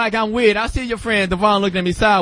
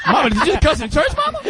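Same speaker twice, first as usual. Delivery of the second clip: put-on kid voice; 7 semitones higher than usual. Kid voice in church story.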